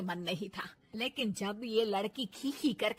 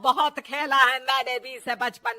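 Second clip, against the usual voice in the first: loud, testy retort; irritable mother-in-law; 10 dB louder than usual. Acted. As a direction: snub the daughter-in-law loudly.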